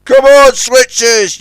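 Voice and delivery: loud high yell. A narrator's reading. Scuttlebutt yelling at the switchers, loud. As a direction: yell at them. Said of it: "yelled Scuttlebutt".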